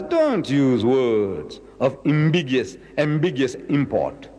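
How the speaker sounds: slow, solemn warning; solemn divine command. Acted. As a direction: lay down the command slowly and gravely. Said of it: "So Allah says".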